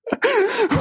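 sudden high laugh; burst of laughter. Real, not acted. [name] laughing at his own panic. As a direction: laugh at yourself, high and quick.

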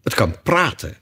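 understated solemn Hare. A narrator's reading. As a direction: declare it with a touch of ceremony. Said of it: "zei Haas plechtig".

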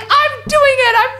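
high, loud imitation of determined mum; comic high-pitched outburst of defiance; mock defiant shout. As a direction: yell back stubbornly, hammed up.

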